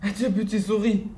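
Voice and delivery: high farewell with a laugh; nervous laughing farewell. Acted. The owl bids the mouse farewell while fleeing, high with a laugh.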